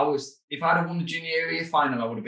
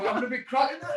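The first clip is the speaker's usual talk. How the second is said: much higher, laughing giddy quote; imitating his younger giddy self; giddy excitement; 13 semitones higher than usual. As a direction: squeak it out, giddy and amazed. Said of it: "And I was like".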